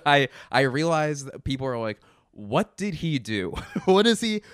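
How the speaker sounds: laughing through the words; giggling as he speaks. Real, not acted. Mock confused onlookers, laughing.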